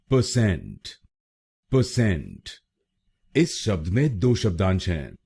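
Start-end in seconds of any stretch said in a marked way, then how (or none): 0.1-2.5 परसेंट said as pə-SENT, light first syllable; correct pronunciation. Shown as a demonstration. Teacher to students, demonstrating correct परसेंट. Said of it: "इसकी सही pronunciation है".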